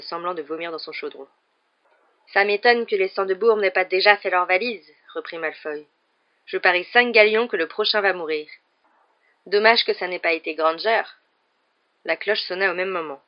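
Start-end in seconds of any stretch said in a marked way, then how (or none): 2.3-4.9 loud, sneering schoolboy; mock the others with a loud sneer; gloating. A narrator's reading. Malfoy taunts classmates with loud gloating. 6.4-11.0 loud cruel taunt; sneering. A narrator's reading. Malfoy wishes harm on Granger, loud sneer.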